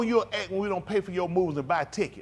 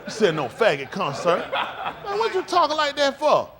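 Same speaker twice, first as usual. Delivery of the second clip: ordinary talk, nothing marked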